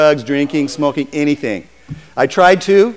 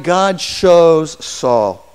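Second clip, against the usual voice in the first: emphatic slow; much slower than usual. Real, not acted. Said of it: "preacher emphasis".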